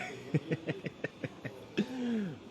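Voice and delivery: real high laugh; laughing hard. Real, not acted. Host cracking up after the son joke, real laughter. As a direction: laugh freely at your own punchline.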